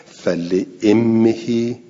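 slow, drawn-out recitation of امهی with kasra on the hamza; careful Quranic recitation of فل امهی; Hamza's reading. Shown as a demonstration. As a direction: recite امهی slowly as "immihi". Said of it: "حمزه و کسائی خوندن چی؟".

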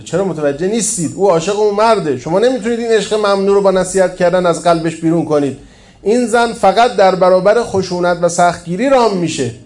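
heated, insistent narrated quote; forceful objection. A narrator's reading. Villain's heated reply in story.

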